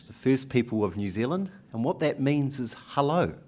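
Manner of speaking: usual speaking voice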